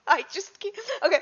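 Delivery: giggling through the apology; laughing. Real, not acted.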